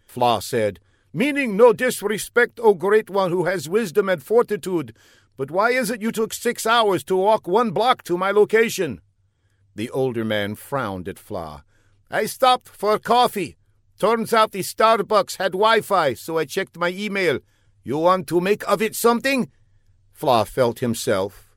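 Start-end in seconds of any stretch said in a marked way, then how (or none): 1.1-9.0 earnest, high put-on foreign voice; an eager young spy calling out; high comic foreigner. A narrator's reading. Fla calls down the block to the older man, high and eager. 12.1-19.5 high, pompous put-on foreign voice; a self-important spy explaining his mistake; high comic foreigner. A narrator's reading. Older man explains to Fla, high and pompous.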